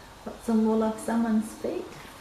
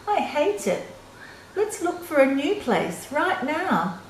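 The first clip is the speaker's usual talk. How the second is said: higher, impatient bird wife; a touch of a cross, bossy bird wife; Missus Bird, fed up; 7 semitones higher than usual. A narrator's reading. Reading to a child, Missus Bird demands a new nest, mildly cross. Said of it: "I'm tired of this old place".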